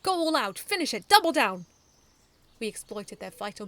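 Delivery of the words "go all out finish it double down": loud, higher rallying orders; urgent battle cry. A narrator's reading. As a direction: push your team all out. Urging the team in battle.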